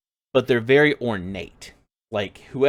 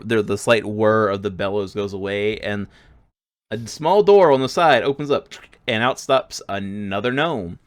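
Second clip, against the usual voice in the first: usual speaking voice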